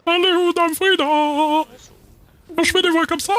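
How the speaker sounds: extremely high, drawn-out falsetto; sing-song way up in head voice; voix de tête suraiguë. Shown as a demonstration. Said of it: "la voix de tête que vous aviez fait très aiguë". (voix de tête très aiguë, traînante)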